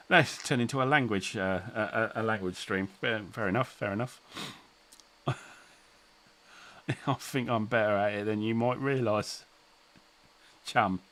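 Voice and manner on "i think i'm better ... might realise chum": Cockney. Pretend. Playing a Cockney to chat.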